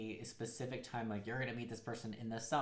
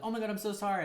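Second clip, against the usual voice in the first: high, louder gush of apology; over-apologetic; 9 semitones higher, 5 dB louder, faster than usual. Acted. You apologizing when something happens, over-apologetic. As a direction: gush out a mock panicked sorry.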